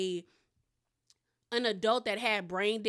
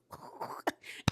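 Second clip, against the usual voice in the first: voiceless mock sobbing; imitated crying; 5 dB quieter than usual. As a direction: imitate her sobs, soft and wordless. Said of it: "Alexia started crying again".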